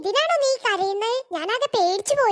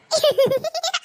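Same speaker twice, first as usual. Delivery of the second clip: gleeful laugh; 7 semitones higher than usual. Acted. Gloating over her prank.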